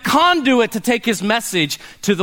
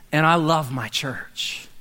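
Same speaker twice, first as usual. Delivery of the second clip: ordinary talk, nothing marked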